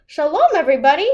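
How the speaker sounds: calling a welcome to her friends. A narrator's reading. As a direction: call out a welcome to the crowd. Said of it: "Baba Brena called".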